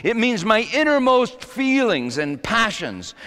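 high, emphatic pulpit delivery; impassioned preaching. Real, not acted. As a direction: preach it out, stretching the big phrases. Preaches on the psalm.

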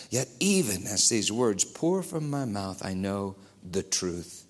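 low, soft solemn narration; hushed storytelling. A narrator's reading. Preacher retelling the story to the congregation quietly. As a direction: tell it softly, drawing them in.

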